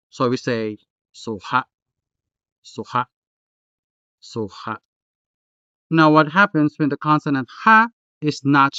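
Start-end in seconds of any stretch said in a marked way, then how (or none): 1.1-4.8 suha with a clear, stressed ha; clearly pronounced h. Shown as a demonstration. Tutor demonstrates for learners, clearly pronounced h in suha. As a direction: say suha, h fully sounded, stress on the last syllable. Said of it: "ha is clearly pronounced".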